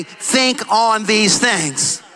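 very slow, gentle scripture line; slow reverent close; slow solemn. Real, not acted. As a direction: let it sink in slowly. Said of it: "slow scripture close".

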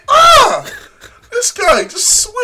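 high, loud yelp of joy; hammed-up goal celebration; excited shout. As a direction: scream like the ball just flew in. Imagining a wonder goal.

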